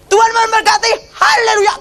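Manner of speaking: high, shouted imitation of a preacher's blessing; [name]. [name] membalas mantra dengan haleluya, high shouted imitated blessing. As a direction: cry out the blessing like a revival preacher. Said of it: "langsung [name] gini".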